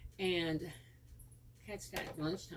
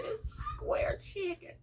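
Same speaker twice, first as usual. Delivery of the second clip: high, slow, drawn-out answer; mock-serious fib; slower than usual. Mother to son at dinner, high slow playful fib. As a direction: sell the fib with a straight face. Mother's deadpan sly reply.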